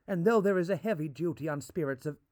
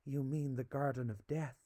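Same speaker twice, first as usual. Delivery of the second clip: hushed, soft question; whispered; 2 semitones lower, 8 dB quieter than usual. A narrator's reading. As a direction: breathe it out in awe. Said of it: "she whispered".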